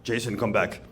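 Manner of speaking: low, quiet summoning call; a governor's low beckon from across the room; Cuomo voice. Retold or imitated. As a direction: call him back like the governor, low and hushed. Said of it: "I hear this voice".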